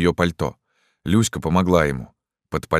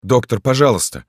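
higher-pitched urgent plea; pleading; 6 semitones higher than usual. A narrator's reading. Yegor begs doctor for wounded girl, pleading. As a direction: beg him, voice raised in pitch. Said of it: "взмолился Егор".

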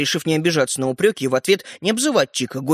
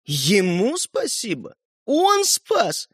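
slow, scornful, rising to high squeak; mock the idea of thanks; sarcastic disbelief; 6 semitones higher, much slower than usual. A narrator's reading. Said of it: "картинно раскланялся". (саркастическое недоверие)

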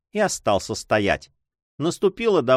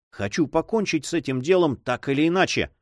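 ordinary delivery, nothing marked

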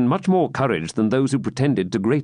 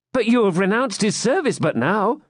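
much higher doubtful jester; questioning jester; 11 semitones higher than usual. A narrator's reading. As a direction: ask warily where to. Jester voiced in story.

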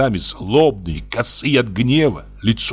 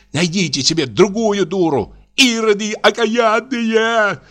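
much higher, furious ranting; Аксинья в ярости; 9 semitones higher than usual. A narrator's reading. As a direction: rage at the whole family. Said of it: "продолжала она". (гораздо выше, яростная брань)

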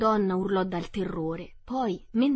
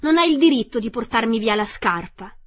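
angry protest; 3 semitones higher, 9 dB louder than usual. Acted. Dorothy quarrels with the witch.

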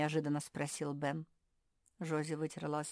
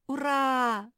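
high, loud hurrah; long jubilant yell; shouted cheer; 9 semitones higher, 10 dB louder than usual. A narrator's reading. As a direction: cry it out in triumph. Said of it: "закричал Бен".

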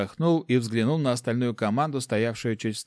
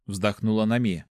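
plain reading voice

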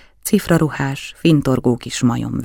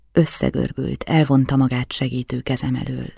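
ordinary delivery

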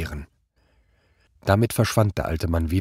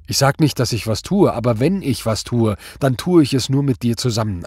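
louder and higher, each clause pressed hard; husband promising his wife, emphatic and louder; emphatic vow; 3 semitones higher, 6 dB louder than usual. A narrator's reading. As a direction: stress wenn and tue firmly.

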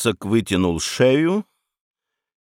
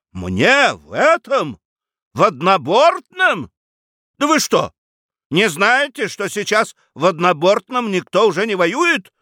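indignant tirade; 6 semitones higher than usual. Acted. Duke rejecting wrong uniform.

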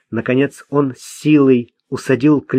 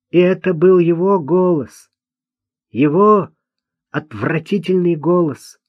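higher-pitched, indignant disgust; disgusted anger; 5 semitones higher than usual. A narrator's reading. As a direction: spit out the memory with distaste. Климан гневно вспоминает ненавистного человека. (с гневным отвращением)

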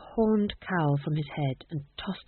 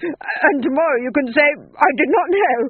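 frantic duke; 8 semitones higher, 8 dB louder than usual. Acted. Duke rehearsing excuses.

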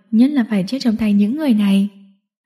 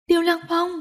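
high, thin frightened call; high-pitched female distress; trembling voice; 7 semitones higher, 4 dB quieter than usual. Acted. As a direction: call his name with a tremble. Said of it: "Giọng Diệu Tinh run run".

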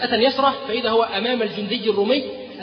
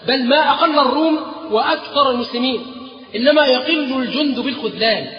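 raised, higher and louder rebuke; angry retort; 5 semitones higher, 4 dB louder than usual. Acted. Khalid scolds a fearful soldier beside him, angry and raised. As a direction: answer the doubter with fierce conviction. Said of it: "قال له مغضبا".

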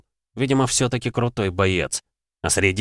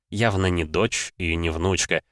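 plain reading voice

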